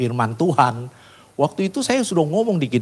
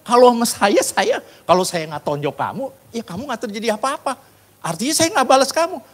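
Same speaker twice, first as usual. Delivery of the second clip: high-pitched, exclaimed I-told-you-so; emphatic admonishing; 10 semitones higher, 4 dB louder than usual. Retold or imitated. Preacher admonishing the injured coworker about mocking God, emphatic warning. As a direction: scold him in a raised, insistent voice. Retells scolding the injured mocker.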